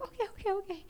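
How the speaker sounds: rattled, trying to calm down; nervous okays. Retold or imitated. Answering Tina Fey after apologizing, high and soft. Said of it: "And I was like".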